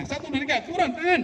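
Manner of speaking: high-pitched count-in to a command; aba-aba tim. Acted. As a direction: count the team in, rising high. Demonstrating a clear count-in command. (hitungan komando bernada tinggi)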